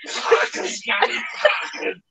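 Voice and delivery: unvoiced mocking parrot "Johnny, crackers"; mock parrot call. Teasing the seller by mimicking her accent as a parrot. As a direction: tease her by squawking it breathlessly. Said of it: "That's what you were doing".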